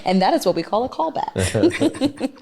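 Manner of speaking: laughing through the words. Real, not acted. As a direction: chuckle while explaining. Laughs at own callback.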